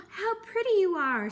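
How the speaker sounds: high, loud delighted praise; admiring crab. A narrator's reading. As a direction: gush over the coral. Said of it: "said Hermit Crab".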